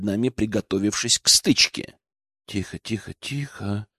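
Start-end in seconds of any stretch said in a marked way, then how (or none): 2.5-3.9 very soft, lower, soothing murmur; soothing hush. A narrator's reading. Игорь strokes trembling Алена's back, soothing her. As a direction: hush someone gently. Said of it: "погладил я Алену по спине".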